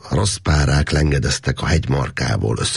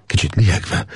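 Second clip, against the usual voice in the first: ordinary delivery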